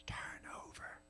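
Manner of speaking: hushed, voiceless whisper. A narrator's reading. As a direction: whisper it softly to a lover lying close. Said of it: "and then I whisper".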